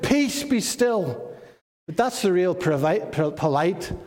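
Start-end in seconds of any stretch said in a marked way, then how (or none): 0.0-1.3 slow command. Retold or imitated. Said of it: "he just shouted".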